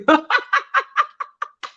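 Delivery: high, rapid giggling laugh; tickled high-pitched laugh; real laughter. Real, not acted. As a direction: laugh hard and high at the compliment. Laughs at a flattering viewer comment.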